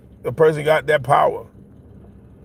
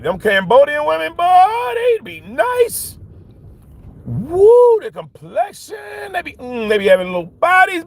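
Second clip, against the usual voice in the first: gushing admiration; 11 semitones higher, slower than usual. Real, not acted. Gushing over Cambodian women.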